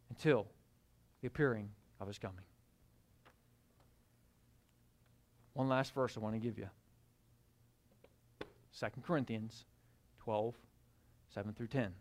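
hushed, low reading of the verse; soft reading. Acted. Preacher softly reading scripture to the congregation. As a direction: drop to a near-murmur for the text.

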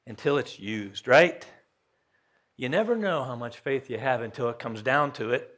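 ordinary delivery

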